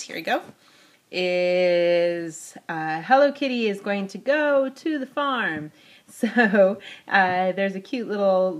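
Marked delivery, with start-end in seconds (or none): none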